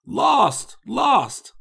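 high, melodramatic wail of ruin; despairing cry. A narrator's reading. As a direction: cry out in stagey despair before a swoon. Ruined suitor cries out, then swoons.

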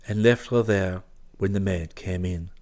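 ordinary delivery, nothing marked

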